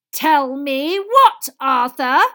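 very slow, loud, high menacing question; dangerous. A narrator's reading. Wife repeats her question to husband, dangerous voice. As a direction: draw each word out as a warning. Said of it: "in a dangerous sort of voice".

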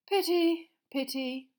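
mournful, falling off; regretful Fudge. Acted. Minister looking over the lake, regretful.

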